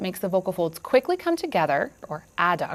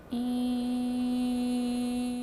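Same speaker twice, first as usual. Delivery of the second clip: long steady "E" held on one pitch; sustained vowel; 5 semitones higher, 7 dB quieter than usual. Shown as a demonstration. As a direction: hold a flat, even "E". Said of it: "like this".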